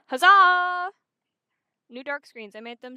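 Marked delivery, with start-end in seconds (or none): 0.1-0.9 loud, higher celebratory whoop; excited cheer. Real, not acted. Streamer showing off new screens to chat, excited cheer.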